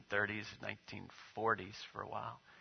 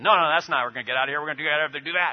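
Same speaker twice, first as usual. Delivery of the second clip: fast, loud and higher mock argument; cutting the other man off; retold shouting; 5 semitones higher, 13 dB louder, much faster than usual. The second worker shouts back over him, hammed-up quarrel. Jeep stuck, men yelling.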